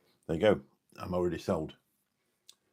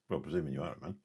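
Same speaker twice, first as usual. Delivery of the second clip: ordinary delivery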